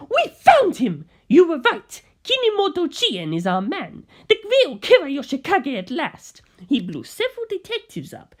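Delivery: a gangster reporting the find, excited; excited gangster on the phone. Acted. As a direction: blurt it out like breaking news. Bucciolati voiced on phone.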